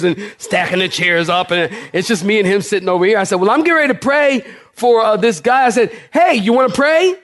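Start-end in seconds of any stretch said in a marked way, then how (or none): 3.4-5.7 calling out. Retold or imitated. Calling across the room. 6.1-7.1 raised voice calling across the room. Retold or imitated. Pastor calls across the room to the nurse, raised voice. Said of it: "Like, way across the room".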